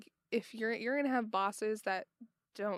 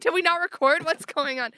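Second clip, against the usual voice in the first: alarmed loud outburst, much louder; panicked, as if the recording failed; shocked; 7 semitones higher, 12 dB louder than usual. Retold or imitated. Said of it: "I was like".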